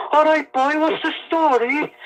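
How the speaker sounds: high, lilting Cork greeting; singsong Cork accent. Shown as a demonstration. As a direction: sing it up like a Cork local. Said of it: "Cork people sing".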